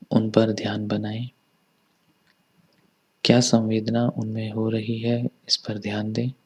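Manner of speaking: soft, unhurried body-scan voice with long pauses; hushed meditation instructor, even and calm; guided meditation. Acted. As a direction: guide them gently inward, leaving room to breathe.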